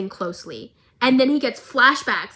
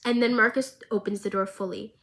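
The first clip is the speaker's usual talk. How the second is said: ordinary talk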